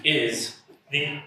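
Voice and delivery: one word per speaker, halting; halting word-by-word delivery; one word at a time. Pretend. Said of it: "They can only speak one word at a time".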